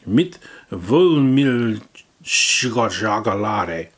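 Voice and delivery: very slow, loud German drawl; mock German accent. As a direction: stretch out the German. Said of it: "repeats slower".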